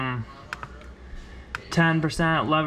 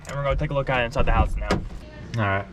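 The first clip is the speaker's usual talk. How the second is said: usual speaking voice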